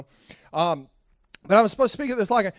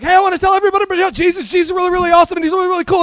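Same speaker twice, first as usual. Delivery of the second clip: fast, loud and high hyped babble; caffeine-hyped; 9 semitones higher, 10 dB louder, much faster than usual. Retold or imitated. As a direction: rattle it off wired on espresso. Imitating himself hyped on caffeine.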